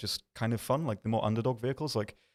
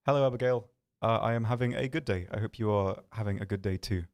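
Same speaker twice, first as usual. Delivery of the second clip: ordinary talk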